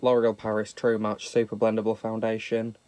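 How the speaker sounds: usual speaking voice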